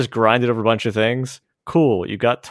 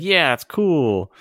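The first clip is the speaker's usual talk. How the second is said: slow, higher-pitched crowd approval; mock enthusiasm; 7 semitones higher, much slower than usual. Imitating people welcoming extreme sports, drawn-out mock enthusiasm. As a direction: draw it out like an impressed fan.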